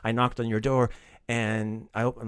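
normal talking voice

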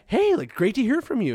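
high, bright-voiced mock of her cheerful greeting; breezy girl imitation. Mimicking the girl on the phone, high and breezy. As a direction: play her as cheerfully oblivious.

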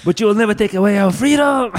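höher, lauter Schlachtruf; brüll es wie ein Anführer vor der Schlacht; Braveheart speech. Retold or imitated.

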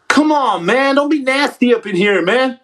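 ordinary talk, nothing marked

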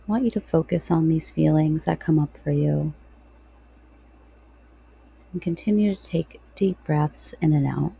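guided meditation. Real, not acted.

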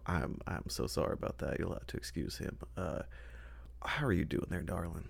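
soft near-whispered polite apology; a hint of gentle whisper excusing his brother; apologetic Humphrey. Humphrey apologizing to the lost girl, soft hushed voice. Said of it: "I go".

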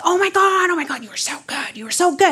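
high-pitched, breakneck gushing; gushing admirers. Retold or imitated. Classmates congratulating dancer at her locker, mock gushing. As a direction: imitate squealing, excited fans. Schoolmates gushing after performance.

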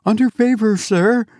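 high, fearful deference; horror-stricken sexton. A narrator's reading. Sexton protesting to the goblin, horror-stricken and high. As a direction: object timidly in a high voice. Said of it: "replied the horror-stricken sexton".

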